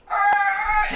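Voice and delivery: loud high monster roar; creature roar. Acted. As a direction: let out a wild roar. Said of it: "all of a sudden it goes".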